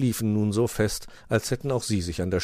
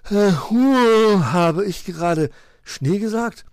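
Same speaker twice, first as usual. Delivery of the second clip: loud hoot, then slow high question; dawning surprise; 12 semitones higher, 8 dB louder, slower than usual. A narrator's reading. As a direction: wake up mid-yawn, astonished. Sleepy Willi suddenly realises.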